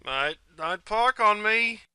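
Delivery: high, drawn-out matey plea; pleading. Acted. Drinker begging his mate not to pike, high slow plea. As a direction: stretch it out, coaxing your mate.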